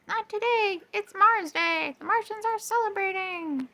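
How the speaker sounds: sing-song it, mind elsewhere; distracted Mars. A narrator's reading. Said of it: "Mars was too distracted".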